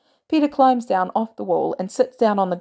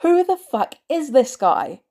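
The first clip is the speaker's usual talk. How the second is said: loud, high furious yell; angry yelling; 6 semitones higher, 4 dB louder than usual. Acted. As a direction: yell at him. May yelling.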